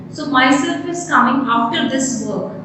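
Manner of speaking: ordinary talk, nothing marked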